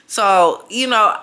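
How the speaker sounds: ordinary talk, nothing marked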